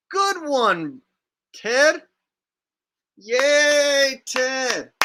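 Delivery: high, loud hammed-up fan cheer; drawn-out mock rally whoop. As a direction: cheer him on with fake enthusiasm. Mock cheering for the played clip.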